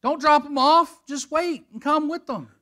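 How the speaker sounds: mock shout, high and loud; imitated scream. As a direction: yell it like he wishes he could. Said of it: "I just wanna scream, and I just wanna go".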